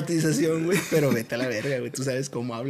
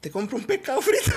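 laughing mock line; 9 semitones higher than usual. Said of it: "¿Qué le dijiste?".

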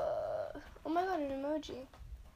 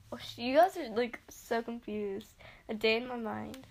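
ordinary talk, nothing marked

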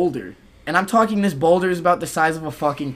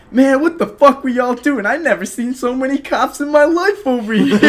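much higher, louder amazed local; amazed Dunkin guy; 10 semitones higher, 5 dB louder than usual. Retold or imitated. Dunkin' Donuts guy amazed at the cops, much higher. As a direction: play the stunned clerk, laughing.

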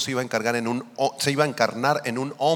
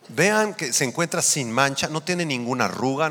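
ordinary talk

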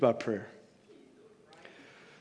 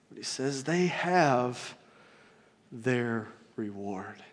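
quiet; 7 semitones lower, 10 dB quieter, slower than usual. Acted. Slow soft emphasis.

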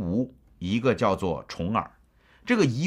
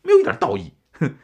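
indignant scoff; 6 dB louder, faster than usual. Real, not acted. Scoffing at a foolish king's rules, loud and indignant. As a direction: pitch jumps way up and louder, scornful. Mocking the ruler's rigid code.

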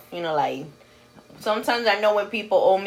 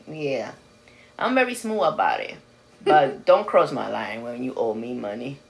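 usual speaking voice

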